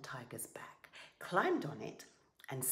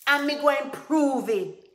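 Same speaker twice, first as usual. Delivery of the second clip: high, very loud roar; furious roar; 9 semitones higher, 16 dB louder than usual. A narrator's reading. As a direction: roar it like an enraged tiger. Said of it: "Tiger roared".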